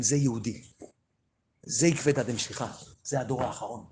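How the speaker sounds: normal talking voice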